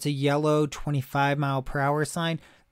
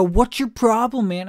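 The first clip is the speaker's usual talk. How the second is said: loud, higher mocking of an annoyed driver; mock angry driver; 6 semitones higher, 6 dB louder than usual. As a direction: throw it out like a fed-up driver. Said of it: "they're gonna go".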